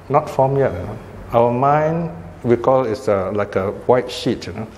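usual speaking voice

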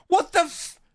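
shocked outburst. Real, not acted. Reacting to rude seller reply.

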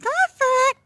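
very high loud pet call; calling the cat. Real, not acted. Owner calling the cat in a very high voice.

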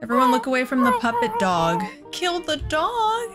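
normal talking voice

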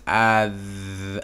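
"Adh-" stretched slowly, sound by sound. Shown as a demonstration. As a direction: blend "Adh-" slowly, holding the th. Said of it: "and then the Khamis, so it's".

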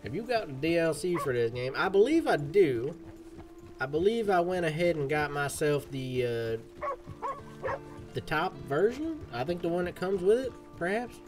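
banter like a cowboy on the trail; cowboy accent. Pretend. Streamer talking to Lenny and chat, cowboy accent. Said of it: "cowboy accent, it ain't too hard for me".

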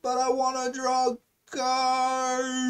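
high, drawn-out mock whine. Player wanting to draw cards, high slow mock whine. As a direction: stretch the last word into a long high whine. Mock whining over a game choice.

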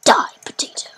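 hushed, voiceless mock menace; playful deadly whisper; whispered threat. Whispered threat repeated while playing.

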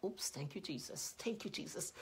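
very soft, hushed aside; chastened thanks. Retold or imitated. Mother checked by the Holy Spirit before scolding, quiet and chastened. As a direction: catch yourself and back off quietly. Catching herself after a warning.

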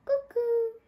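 high, loud clock cuckoo; cuckoo call. A narrator's reading.

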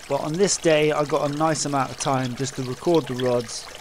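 usual speaking voice

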